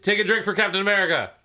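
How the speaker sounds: rally everyone to drink; excited toast. Real, not acted. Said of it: "proposing toast, laughing".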